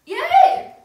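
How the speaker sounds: high, loud yay; excited cheer. Real, not acted. Celebrates a good flavour.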